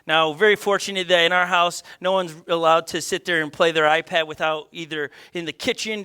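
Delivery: ordinary talk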